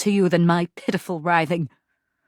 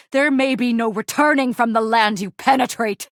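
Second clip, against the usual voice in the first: ordinary delivery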